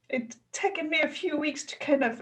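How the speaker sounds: fighting back real tears; real emotion welling up; choking up. Real, not acted. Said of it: "I don't know why I'm getting so emotional now".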